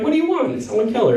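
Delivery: high incredulous protest; exasperated retort. Retold or imitated. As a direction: toss it back at her, amazed. Arguing with friend, mock exasperated.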